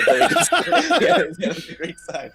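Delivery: high, cracking laughter through words; laughing. Real, not acted. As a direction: lose it laughing mid-sentence. Laughing at the joke.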